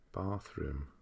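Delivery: bathroom with a long "ah", like bahth-room; long-vowel bathroom. Shown as a demonstration. Trying out the word aloud, Bathroom with a long "ah". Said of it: "what's the bathroom".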